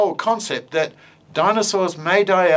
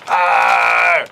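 loud puppet roar; angry dinosaur roar; 6 dB louder than usual. Acted. Puppet dinosaur roaring at creatures, loud angry roar. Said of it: "a very angry dinosaur".

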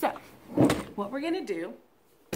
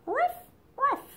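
high yappy puppy bark; dog bark. Shown as a demonstration. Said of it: "doggies go like this".

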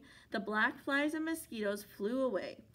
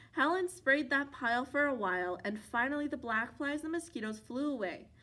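ordinary delivery

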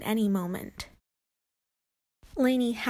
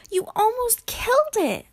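high, loud distressed wail; 12 semitones higher, 6 dB louder than usual. A narrator's reading. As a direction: wail it accusingly at mom. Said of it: "Lainey wailed".

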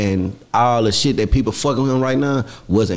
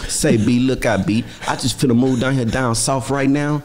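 ordinary talk

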